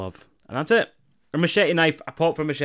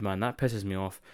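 ordinary talk, nothing marked

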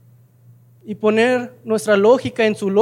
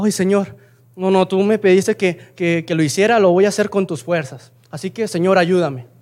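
ordinary talk

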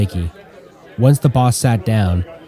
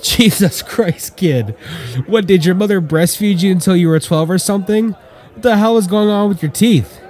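mocking; 9 semitones higher than usual. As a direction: stretch out Jesus Christ and sneer at the kid. Brolin mocks the kid.

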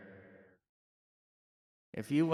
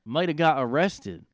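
redone line; 5 semitones higher, 7 dB louder than usual. Shown as a demonstration. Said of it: "I don't know why I said it like that".

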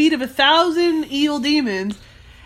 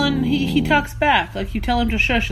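usual speaking voice

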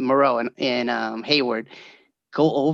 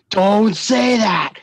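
daughter warning; 6 semitones higher, 6 dB louder than usual. Retold or imitated. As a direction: beg him not to tempt fate. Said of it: "she'll be like".

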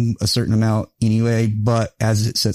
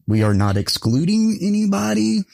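ordinary talk, nothing marked

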